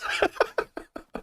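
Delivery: real breathy laugh, trailing off. Real, not acted. Laughing after retelling the text exchange with mom, real laugh. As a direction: laugh quietly at your own story.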